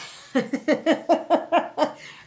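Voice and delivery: loud, high giddy laugh; giddy burst of laughter. Real, not acted. Vlogger laughing giddily before the big reveal. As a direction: let the excitement spill into laughter.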